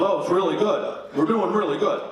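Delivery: son's cheerful brushed-off reply, voiced apart; breezy son brushing it off; lightly voiced son keeping it short.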